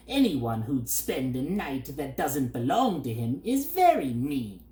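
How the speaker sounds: the bird's voice, smug and lecturing; scolding bird. A narrator's reading. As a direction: sneer like a pompous bird. Bird figure.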